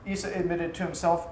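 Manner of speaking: ordinary delivery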